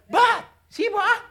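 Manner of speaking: high, stretched name broken in two for a pun; joking exclamation. Acted. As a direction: play David calling out "Bath... Sheba?". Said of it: "and David said".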